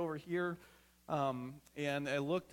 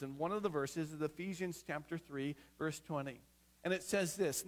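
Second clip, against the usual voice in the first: normal talking voice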